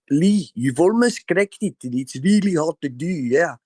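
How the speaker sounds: put-on a rough go at a South African friend's accent. Quoting his South African friend Adam Bear, light South African accent. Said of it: "sort of sound a little bit like it".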